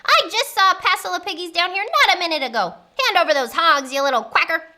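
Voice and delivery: high, loud, rapid scolding; bossy witch berating someone; angry witch. Acted. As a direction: rattle off your demand in a temper.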